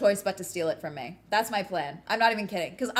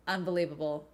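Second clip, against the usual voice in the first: usual speaking voice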